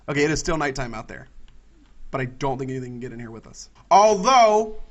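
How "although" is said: startled, louder rethink; sudden alarm. Real, not acted. Gamer startled while planning his shelter, louder. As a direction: catch yourself in alarm.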